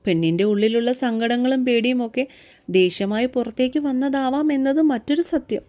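usual speaking voice

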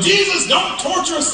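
a sharp, high mock yell at Jesus; demons yelling. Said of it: "they're yelling".

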